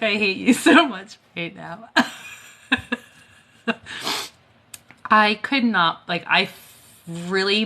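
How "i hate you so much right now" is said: laughing. Real, not acted. Laughing at the labelled taps.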